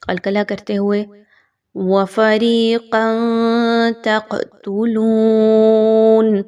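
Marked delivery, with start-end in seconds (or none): 1.7-6.4 slow chant, ق in تَقْتُلُونَ bounced; qalqala demo. Shown as a demonstration. Teacher recites with qalqala on ق. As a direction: echo the ق in تَقْتُلُونَ. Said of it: "یہ قاف جو تھوڑی سی ہلائی جا رہی ہے".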